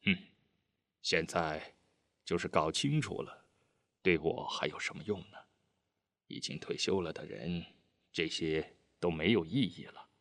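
low, subdued scorn; understated bitter dismissal, softly spoken; cold sneer. A narrator's reading. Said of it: "但随即又冷笑道". (低声冷笑，略带不屑)

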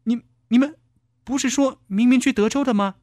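high-pitched stammering protest; startled. A narrator's reading. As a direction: blurt it high, thrown by the shock. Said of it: "少丽惊得差点跳了起来".

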